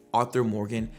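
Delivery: ordinary talk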